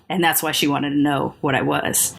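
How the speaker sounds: normal talking voice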